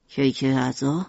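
husband refusing to rest, low and firm; stubborn father. Acted. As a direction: refuse firmly, pitched low. Mishima voice.

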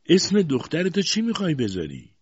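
high, loud, domineering query; imperious question. A narrator's reading. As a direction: demand an answer like a bossy aunt. Aunt questions mother.

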